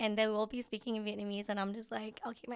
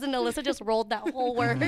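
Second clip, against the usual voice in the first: laughing, louder; 5 dB louder than usual. Real, not acted. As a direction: laugh through the words. Laughing while retelling.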